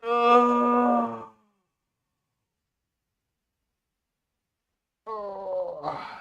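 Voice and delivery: loud high yawn stretching out; big yawn. Real, not acted. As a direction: let a long yawn out. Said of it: "for yawning like that".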